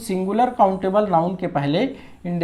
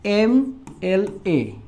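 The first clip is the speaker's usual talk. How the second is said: "एम एल ए" letter by letter, drawn out; spelled out slowly; much slower than usual. Shown as a demonstration. Teacher showing how it is pronounced, "एम एल ए" slow and separated. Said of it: "तो कैसे उच्चारण कर रहे हैं".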